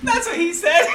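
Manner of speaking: high-pitched, squealed through laughter; laughing hard. Real, not acted. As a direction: squeeze the words out while cracking up. Cracking up at crude joke.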